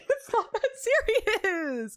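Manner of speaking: slow, laughing, stress on not; amused and drawn-out dismissal. Real, not acted. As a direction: laugh through "not that serious".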